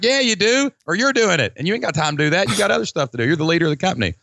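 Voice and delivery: high-pitched, insistent comeback; emphatic retort. Retold or imitated. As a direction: push back hard, voice jumping up. His retort to reluctant clients.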